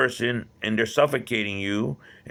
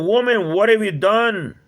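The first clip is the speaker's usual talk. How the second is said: high, loud, shocked accusation; shocked outcry; 9 semitones higher, 7 dB louder than usual. Retold or imitated. Man after finding fetus confronts woman, high loud shocked cry. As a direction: cry it out in horror. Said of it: "I was shocked".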